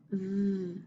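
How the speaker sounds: V as a soft held buzz; isolated letter sound. Shown as a demonstration. Teacher drilling sound cards, V softly buzzed. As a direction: buzz the start of vest, V, not the letter's name. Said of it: "do our letter sound cards now".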